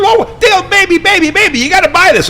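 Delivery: wife begs husband to buy the land, high excited shout; imitating excited wife. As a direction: squeal it like a wife begging her husband to buy. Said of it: "she was all excited".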